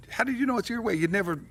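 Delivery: high-pitched mock indignation; indignant retort. Husband challenging his wife over ribs, mock indignant. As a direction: throw it back as a mock protest.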